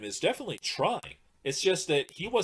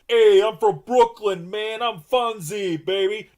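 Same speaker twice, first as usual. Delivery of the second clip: loud, slow hammed-up Brooklyn Italian guy; mock the turtles' Brooklyn tough-guy talk; 7 semitones higher, 12 dB louder, slower than usual. Reviewer mocking the turtles' voices, hammed-up Brooklyn accent. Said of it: "And I'm talking the stereotypical".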